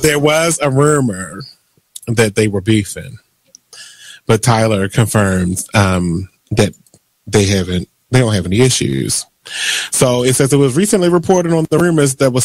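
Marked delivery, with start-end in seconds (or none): none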